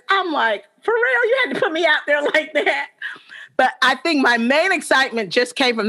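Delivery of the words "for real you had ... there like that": high for real, laughing through it; laughing mock outrage at the anchor; mock indignation. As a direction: tease the anchor for exposing you.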